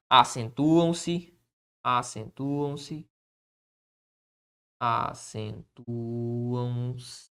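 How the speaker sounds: slow, soft dictation to the board; dictating while writing. Shown as a demonstration. Teacher dictating the rule as he writes it, slow and soft. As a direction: spell it out slowly as you write.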